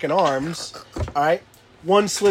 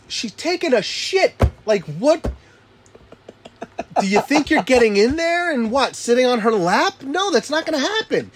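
higher incredulous scolding; exasperated dad; 10 semitones higher than usual. Retold or imitated. Dad scolding kid pounding on bathroom door, higher pitch.

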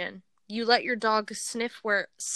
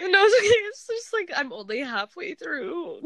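laughing; 8 semitones higher than usual. Real, not acted. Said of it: "trying not to laugh".

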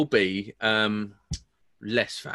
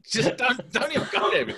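laughing; 10 semitones higher than usual. Real, not acted. Laughing tease at Rick.

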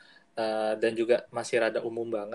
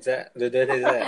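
laughing; 8 dB louder than usual. Real, not acted. Laughing while passing the turn.

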